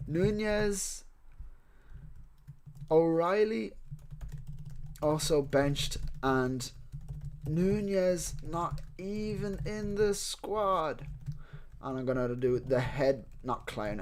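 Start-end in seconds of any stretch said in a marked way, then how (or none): 0.1-11.1 slow, drawn-out words while typing a tweet; halting dictation to yourself with long pauses; composing aloud. Real, not acted. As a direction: say it piece by piece, stretching the words. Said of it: "Let me go to my tweet".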